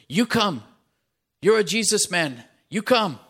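high and loud, insistent; urgent command. Acted. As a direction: pull the boy along with your voice. Rider commanding the boy.

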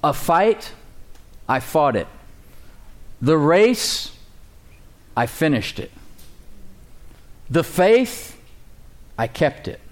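each phrase set apart by long pauses; deliberate pauses. Shown as a demonstration. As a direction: read it slowly, one phrase at a time. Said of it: "says it like this".

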